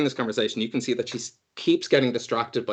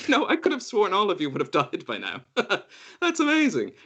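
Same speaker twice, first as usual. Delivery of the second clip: higher, airy and cheery; breezy Rua; 9 semitones higher than usual. Acted. Rua surprised the party survived, airy and high. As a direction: toss it off lightly.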